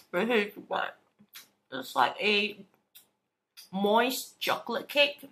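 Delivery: chewing between words; talking while chewing. Real, not acted. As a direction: mouth partly full of cake. Seen 0: bitten cake in hand, lips pressed, cheek working between words.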